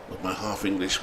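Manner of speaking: ordinary talk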